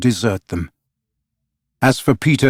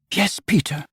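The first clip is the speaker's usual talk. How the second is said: higher, softer, gently insistent plea; Wendy insisting softly; 7 semitones higher, 6 dB quieter than usual. A narrator's reading. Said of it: "Wendy insisting".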